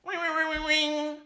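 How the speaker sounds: high sing-song ringing; mock ringtone voice; phone ring imitation. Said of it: "I'm gonna call the Trevor Project hotline".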